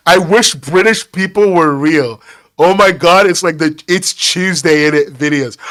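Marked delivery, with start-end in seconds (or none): none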